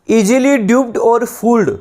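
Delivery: slow dictation. Shown as a demonstration. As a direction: read it out slowly for notes. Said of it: "reads the definition out". (Notes के लिए बहुत धीरे बोलना)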